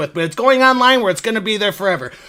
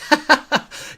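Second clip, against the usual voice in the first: short, higher-pitched chuckle; oblivious laugh; 6 semitones higher than usual. Retold or imitated. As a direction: laugh along with the customer. Polite laugh at customer's remark.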